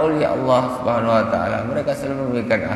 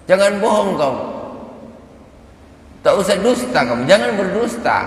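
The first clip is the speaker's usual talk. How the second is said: much higher, louder scolding; stern rebuke; 11 semitones higher, 4 dB louder than usual. Retold or imitated. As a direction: scold him like a strict teacher catching a lie. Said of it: "kata Sayyidah Rabi'ah".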